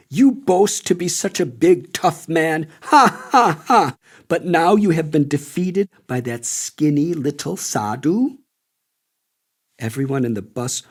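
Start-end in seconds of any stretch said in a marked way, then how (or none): 0.1-8.4 higher, louder mocking jeer with a cackle; mock the agent to his face; ridiculing taunt. Old widow on the bus mocking the humiliated agent, loud taunting laugh. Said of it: "An old widow ridiculed him".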